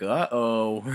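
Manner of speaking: loud, hammed-up uh-oh; mock alarm. Telling how everyone reacted to the hooker news, mock alarm. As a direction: play an exaggerated oops.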